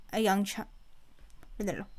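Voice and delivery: plain reading voice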